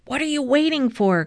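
high, fast yell; impatient yell. A narrator's reading. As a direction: urge the player on from the sideline. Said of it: "Coach Frensky yelled".

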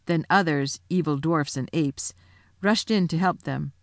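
ordinary delivery

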